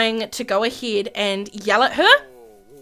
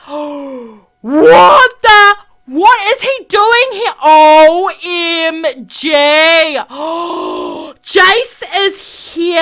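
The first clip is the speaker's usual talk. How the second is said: loud gasp and high, loud shock; loud stunned exclamation; 3 semitones higher, 7 dB louder, much slower than usual. Real, not acted. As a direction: gasp and cry out in disbelief.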